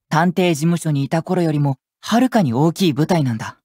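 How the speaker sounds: ordinary talk, nothing marked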